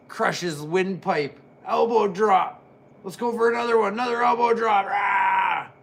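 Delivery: loud excited play-by-play call; wrestling commentator. Acted. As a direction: call the match from ringside. Live ringside play-by-play.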